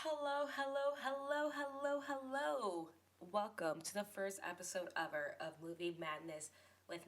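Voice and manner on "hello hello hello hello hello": sing-song hello. Acted. Sing-song greeting opening the podcast.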